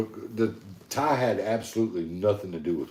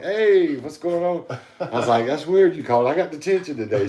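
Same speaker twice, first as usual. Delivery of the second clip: cheery greeting; 7 semitones higher, 5 dB louder than usual. Retold or imitated.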